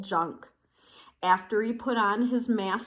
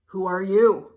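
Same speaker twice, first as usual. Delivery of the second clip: gruff demand; 7 dB louder than usual. A narrator's reading. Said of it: "growled Don Diego".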